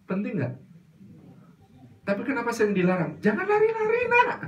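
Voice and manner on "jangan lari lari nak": high, fussy mother's call; high-pitched mock mother scolding her child. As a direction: call out like an anxious mom.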